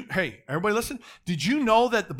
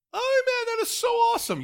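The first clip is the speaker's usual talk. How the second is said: much higher, excited burst of joy; thrilled exclamation; 6 dB louder than usual. Real, not acted. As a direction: rejoice out loud, real delight. Preacher's own delight.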